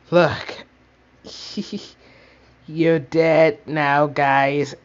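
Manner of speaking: loud, slow menacing taunt; villain threat. Pretend. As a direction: bellow it like a toy villain. Toy villain threatening the others.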